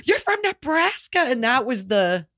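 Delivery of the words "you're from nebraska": high-pitched retold shriek after a gasp; gasp, then squeal it without real volume; excited mock scream. Two new friends discovering a shared home state, mock screaming.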